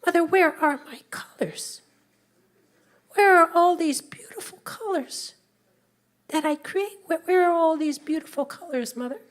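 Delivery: high, pleading and distressed; upset. Acted. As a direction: cry out to mother in dismay. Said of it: "upset him so much".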